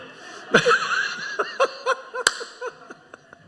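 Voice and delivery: hearty laugh at his own joke; breathy high laugh. Real, not acted. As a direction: let out a wheezing laugh.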